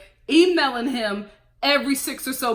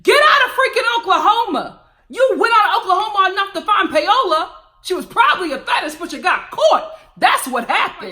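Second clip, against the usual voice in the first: louder and higher, exasperated; exasperated rant; 8 semitones higher, 6 dB louder than usual. Real, not acted. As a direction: yell at the TV about Russ. Ranting at Russ on the show.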